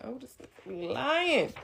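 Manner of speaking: high, slow disbelieving drawl; incredulous. Retold or imitated. To herself on hearing his story, high incredulous drawl. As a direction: stretch it out in total disbelief. Her own inner disbelief, voiced.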